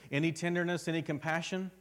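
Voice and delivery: loud, rising call to the congregation; full-force preaching. Acted.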